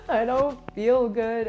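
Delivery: slowly, like crying into someone's arms; retold crying. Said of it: "cry into Dev's arms".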